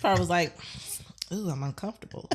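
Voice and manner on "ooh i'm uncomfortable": hushed, uneasy aside; imitating an uncomfortable friend under the breath; awkward whisper. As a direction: whisper it as if squirming. Imagines the friend's awkward reaction.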